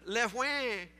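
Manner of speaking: mock newborn wail, French-accented; French-accented baby cry. Preacher guessing at French babies' cries, mock French-accented wail. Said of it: "cries with a French accent".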